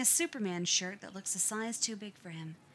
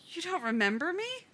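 mock disbelief; 10 semitones higher, 6 dB louder than usual. A narrator's reading. Brother teasing, mock disbelief.

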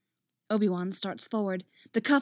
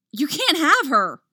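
loud, high cry of alarm; 7 semitones higher, 10 dB louder than usual. A narrator's reading. Anakin protects his master from Dooku, alarmed shout. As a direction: shout it out in panic. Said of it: "alarm in his voice".

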